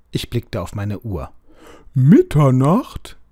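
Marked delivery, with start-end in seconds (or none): 1.9-3.2 slow, loud alarmed cry; badly startled. A narrator's reading. Owl learns it is midnight, a loud frightened cry. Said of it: "offensichtlich höchst erschrocken".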